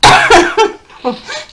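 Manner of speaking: a loud high cough that breaks into a laugh. Real, not acted. Reader losing her voice mid-reading, coughing into a laugh. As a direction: cough it out, then laugh at yourself. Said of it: "Je suis en train de perdre ma voix".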